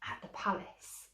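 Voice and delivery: breathy whisper; whispered aside. A narrator's reading. Stepsister whispering about the palace. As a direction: hush it like a secret.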